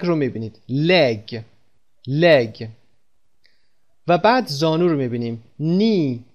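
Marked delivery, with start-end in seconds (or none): 5.6-6.2 "نی" for knee, starting straight on n; silent K. Shown as a demonstration. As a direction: say "نی" with a long ee and no k. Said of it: "کی در اینجا تلفظ نمیشه".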